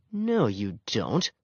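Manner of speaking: slow, soft growled retort; growling dog. A narrator's reading. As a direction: talk back slowly, like a growling dog. Growling dogs argue in turn.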